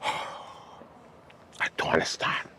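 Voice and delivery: sighed, whispered disbelief; exasperated whisper. Real, not acted. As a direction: breathe it out, barely voiced. Exasperated sigh at the news.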